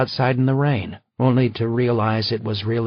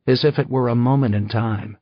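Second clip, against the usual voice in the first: usual speaking voice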